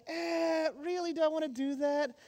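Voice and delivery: a hesitant, reluctant giver, mildly acted; soft, doubtful mock hesitation; hesitant churchgoer. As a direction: hem and haw over the wallet. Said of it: "you pull out your wallet and you're like".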